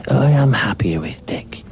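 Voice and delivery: whispered commentator voice; hushed snooker commentary. Acted.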